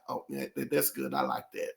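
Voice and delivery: a man content to just sit there, low and easy; lazy, satisfied Sir Charles. Retold or imitated. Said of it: "he's just wanna just sit there".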